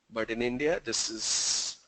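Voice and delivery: hushed, conspiratorial demo; hushed aside. Shown as a demonstration. Teacher showing the Indian head wobble quietly. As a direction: drop to a near whisper on this is. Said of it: "the Indian way of saying yes".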